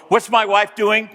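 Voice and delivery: normal talking voice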